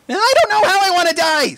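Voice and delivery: high loud terrified outburst; filly screaming in terror; panicked scream. A narrator's reading. Screaming under the slime.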